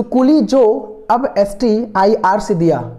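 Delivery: measured recital with gaps between chunks; slow dictation. Shown as a demonstration. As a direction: repeat the mnemonic slowly for learners. Repeating the memory trick slowly.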